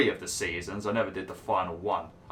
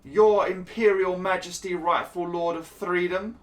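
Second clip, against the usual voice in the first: hammed-up courtly lady, higher throughout; exaggerated noble lady addressing a duke; mock posh lady; 10 semitones higher than usual. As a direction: grovel grandly to royalty, tongue in cheek. Reading dialogue options in held voice.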